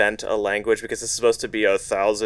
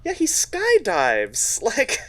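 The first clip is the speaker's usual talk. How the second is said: amused; 11 semitones higher, slower than usual. Real, not acted. Joking about the hero's jumps.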